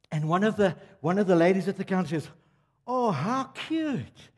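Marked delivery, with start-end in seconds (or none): 2.9-4.3 high, drawn-out cooing; imitated shop lady melting over a child; gushing shop lady. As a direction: coo slowly in a raised voice.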